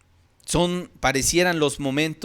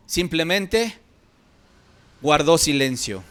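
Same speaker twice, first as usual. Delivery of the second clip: louder, higher impassioned sermon voice; fervent preaching; 6 semitones higher, 6 dB louder than usual. Acted. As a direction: preach it with rising fervour. Preacher rises in fervour. (predicación fervorosa, más alta y fuerte)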